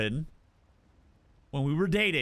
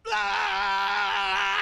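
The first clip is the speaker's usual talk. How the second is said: high excited yell; 13 semitones higher than usual. Retold or imitated. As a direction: whoop high to rile up the dogs. Yelling to rile the dogs.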